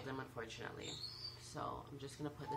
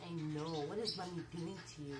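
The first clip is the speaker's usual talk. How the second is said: ordinary talk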